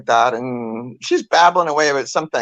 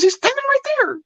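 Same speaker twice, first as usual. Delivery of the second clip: put-on much higher, impatient old lady; exasperated grandmother. Grandmother insisting to her son, higher and impatient. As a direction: play the cranky grandma. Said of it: "She goes".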